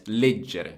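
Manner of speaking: leggere with the stress on LEG, drawn out slowly; stress on first syllable. Shown as a demonstration. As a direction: lean on the opening syllable of leggere. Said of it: "it's on the first syllable".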